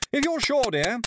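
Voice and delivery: very high, doubtful hostess; fluttery reluctant consent; helpless. A narrator's reading. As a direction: give in, flustered and unsure. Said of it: "she said helplessly".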